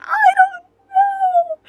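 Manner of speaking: high, slow, wailing "I don't know"; sobbing. Retold or imitated. Questioned by the vice principal, retold sobbing. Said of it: "I was, like, sobbing".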